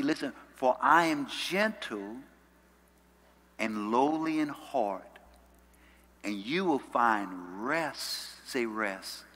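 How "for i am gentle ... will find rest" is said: slow, measured scripture reading. Real, not acted. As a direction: draw each phrase out, pausing between them. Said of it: "I wanna read it slowly".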